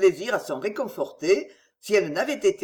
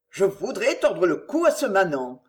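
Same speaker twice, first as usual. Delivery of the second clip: plain reading voice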